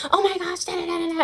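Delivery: high, overdramatic fuss; mock dramatic gasp. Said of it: "and you're like".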